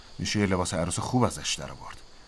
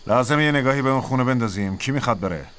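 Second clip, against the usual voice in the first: raised, carrying command voice; calling for volunteers; 5 semitones higher, 5 dB louder than usual. Acted. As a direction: address the squad, loud and higher, easing at the end. Sergeant asks for volunteers.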